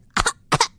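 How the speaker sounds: breathy unvoiced parrot cough. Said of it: "That's an excellent parrot impression".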